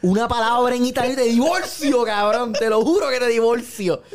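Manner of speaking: mock furious wife warning her husband; angry wife threat. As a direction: threaten him like a fed-up wife. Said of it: "la primera esposa le dice".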